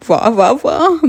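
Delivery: high, gleeful cheer; thrilled at a dream come true; delighted witch. Acted. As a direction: cheer with storybook glee, pitched high.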